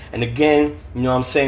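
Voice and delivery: ordinary delivery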